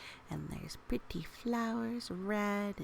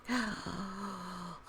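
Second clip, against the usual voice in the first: dismayed gasp. Real, not acted. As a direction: gasp at the mess. Reader reacts to melting sweets.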